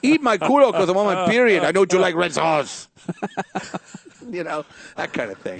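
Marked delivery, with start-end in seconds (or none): 0.0-2.9 comic riff as Sofia Vergara calling her husband. Retold or imitated. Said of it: "my husband's like".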